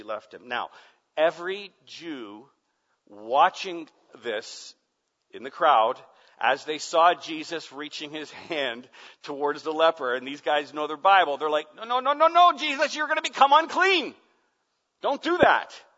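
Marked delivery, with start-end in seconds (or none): 1.2-11.4 loud, high, impassioned preacher voice; full-force pulpit cry; fiery preaching. Acted. Preaching build. 11.7-15.8 loud high alarmed protest; panicked loud objection; alarmed crowd. Pretend.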